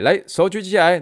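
high, loud barked order; shouted command. Retold or imitated. Drill instructor orders the class to raise hands, shouting.

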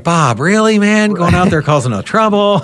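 lightly voiced cohost ribbing Bob for stirring trouble; mock scolding Neil. Host imitating a cohost scolding him, playful mock reproach. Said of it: "Neil was gonna be like".

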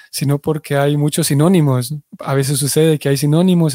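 ordinary talk, nothing marked

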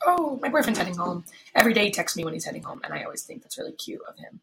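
ordinary talk

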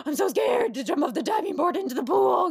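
high, loud mock-terrified kid voice; a kid voiced high and loud, hammed-up fear; scared little kid. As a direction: play the frightened kid on the diving board. Kid scared at diving board.

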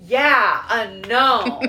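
hammed-up mom admitting it; mom realizing. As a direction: mom caught out, played up. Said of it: "she was like".

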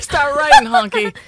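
laughing, high mock order. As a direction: giggle out the jab. Echoing, laughing.

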